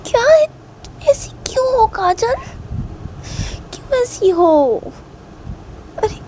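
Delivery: exasperated. Acted. Reshma answering Kajal's accusation about her looks, high exasperated protest. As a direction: plead in high-pitched disbelief.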